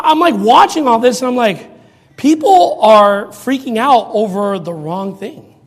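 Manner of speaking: ordinary delivery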